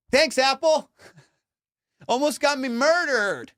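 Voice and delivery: high, loud mock gratitude; sarcastic thanks. Real, not acted. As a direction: thank them through a laugh.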